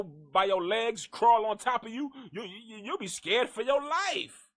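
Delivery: ordinary delivery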